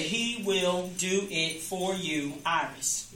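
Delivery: very slow, soft, every word spaced; slow affirmation. Shown as a demonstration. Teaching her old seven-word prayer, slow and soft. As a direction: let each word sink in slowly. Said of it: "I used to always say".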